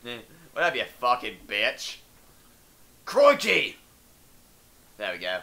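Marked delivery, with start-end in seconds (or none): 0.5-3.8 light Australian impression; Australian accent. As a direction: ham up the Aussie, shout the crikey. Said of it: "at least I just say crikey".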